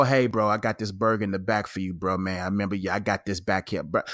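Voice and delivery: a hint of a low, soft cook offering a burger; lightly voiced friendly cook. To a regular he likes, a low, quiet friendly cook. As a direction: slip the guy a favor, low and quiet.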